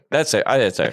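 normal talking voice

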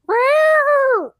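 very high, loud crying meow; wailed meow for a cow; silly meow. Retold or imitated. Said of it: "the cow makes this sound".

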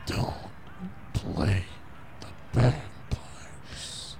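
devil whisper. Acted. Satan whispering temptation.

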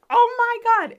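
high, loud "oh my God" of amazement; retold astonished shout. Four customers stunned by the card trick, shouting in amazement. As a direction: blurt it out like the whole bar is stunned.

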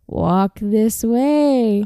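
very slow, drawn-out movie hunchback voice; slow mock hunchback line; hunchback guy. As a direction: stretch it out like the creepy film servant. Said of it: "the little hunchback guy goes".

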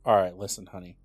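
put-on low, soft coaxing boyfriend; mock sweet-talking boyfriend. Man pitching a prank to his girlfriend, softly coaxing. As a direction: lightly act a boyfriend easing into a request. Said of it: "go to his girlfriend and be like".

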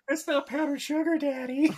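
high character voice. Acted. Playing a character's line.

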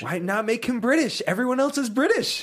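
high-pitched, incredulous complaint; exasperated protest. Real, not acted. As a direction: protest it as utterly obvious. Exasperated echo of co-host's complaint.

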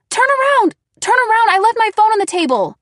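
high, loud frantic scream. A narrator's reading. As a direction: scream in panic. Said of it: "and then I screamed".

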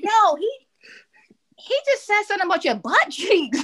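high, excited retelling through laughter; high-pitched mock alarm, laughing; amused friends' reaction. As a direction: imitate astonished friends, pitch leaping up, cracking up.

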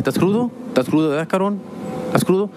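high, knowing teasing questions; teasing dad. Retold or imitated. Dad teases his hungover son, high and knowing. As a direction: needle your son about the hangover.

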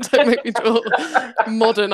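repeating the complaint through a laugh. Retold or imitated. Artist repeating her drawing complaint, laughing. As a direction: giggle through the words. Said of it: "I'm more like".

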